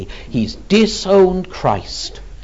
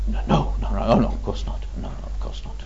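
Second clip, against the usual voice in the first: soft, low, repeated brush-off; hushed denial; 6 semitones lower, 6 dB quieter, faster than usual. Retold or imitated. Peter denying Jesus to the girl at the gate, hushed. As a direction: mutter it away under your breath.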